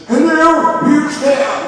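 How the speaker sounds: put-on high, loud old-man voice; old man answering. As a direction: croak out a phone greeting. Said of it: "some old guy would answer".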